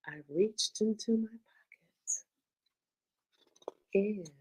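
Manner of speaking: hushed, slow whisper. Acted. Reading the suspense to listening kids, whispered. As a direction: lean in and whisper the secret.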